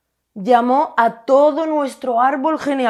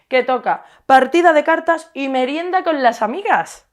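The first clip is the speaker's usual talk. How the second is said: normal talking voice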